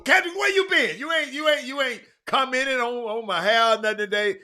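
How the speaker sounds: high, loud, teasing challenge; loud calling out. Real, not acted. Host to Kendrick who hasn't commented, loud calling out. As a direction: call out the absent regular at full volume.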